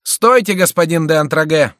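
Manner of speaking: loud, high urgent call; shouted halt. A narrator's reading. Шико halts Антраге as the fight begins, shouting. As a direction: shout to stop him. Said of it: "крикнул Шико".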